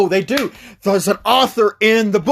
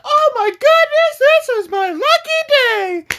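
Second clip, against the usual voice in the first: high falsetto, louder; gushing high-pitched old lady; excited lady; 4 dB louder than usual. Retold or imitated. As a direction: squeal with delight in a high put-on voice. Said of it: "she's like".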